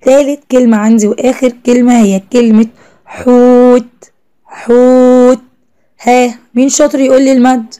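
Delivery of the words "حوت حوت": حوت with the "oo" held long and level; drawn-out "hoooot", sounding out حوت; long vowel in حوت. Shown as a demonstration. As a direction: stretch the oo of حوت for pupils. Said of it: "حوت مد بالواو".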